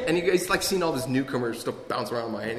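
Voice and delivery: normal talking voice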